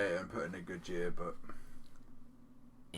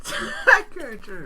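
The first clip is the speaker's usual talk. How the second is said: laughing; 14 dB louder, much slower than usual. Real, not acted. Laughing at the pun.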